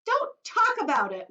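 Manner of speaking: high, loud, slow wail; mother begging them to stop. Acted. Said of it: "wailed Missus".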